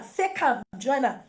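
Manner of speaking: usual speaking voice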